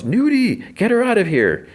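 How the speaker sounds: high-pitched imitation of a yell; mock yell. Dude yells at a naked stranger, high mock yell. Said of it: "So the dude yells".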